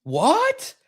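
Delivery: high rising shocked what; hammed-up surprise; mock shock. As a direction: throw up a startled what.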